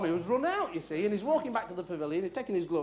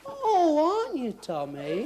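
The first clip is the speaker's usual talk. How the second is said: high, loud "oh" dropping into mock sympathy; hammed-up concern; 6 semitones higher, 4 dB louder, much slower than usual. Friend hears Tommy is unwell, mock concern. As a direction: overplay the concern for a sick friend. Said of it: "Do you hear the concern in my voice?".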